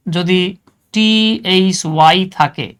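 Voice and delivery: ordinary delivery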